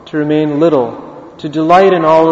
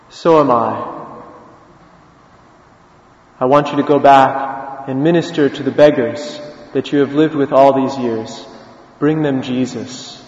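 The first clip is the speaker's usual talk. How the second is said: low, measured, tender retold words; gentle commissioning; 3 semitones lower, 4 dB quieter than usual. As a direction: send him off quietly and solemnly. Pope gently commissions the beggar.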